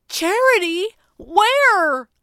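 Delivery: loud, high, aghast disbelief; sputtering. A narrator's reading. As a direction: burst out in shocked questions. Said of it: "Jeremy sputtered".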